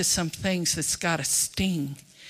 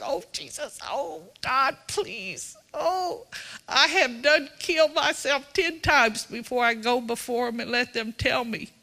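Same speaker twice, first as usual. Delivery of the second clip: higher, pleading mock prayer; mock anguished prayer; 5 semitones higher than usual. Preacher acts his own guilty prayer, higher and pleading. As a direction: ham up a panicked plea to God. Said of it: "I'm like".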